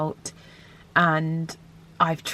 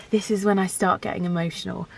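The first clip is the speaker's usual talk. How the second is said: normal talking voice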